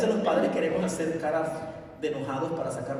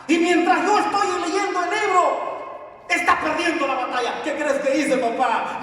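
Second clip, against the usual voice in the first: louder, higher, crying out at the loss; impassioned shout; 8 dB louder than usual. Acted. Preacher voicing the child to the congregation, loud anguished cry. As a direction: shout it like the hero is losing.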